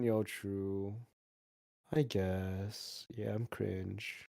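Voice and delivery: muttered shame, low and small; barely audible mope. Pretend. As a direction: mumble your apology into nothing. Mock shame, very quiet.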